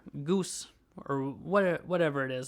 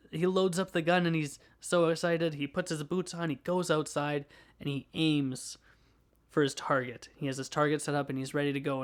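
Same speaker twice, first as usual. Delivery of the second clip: ordinary delivery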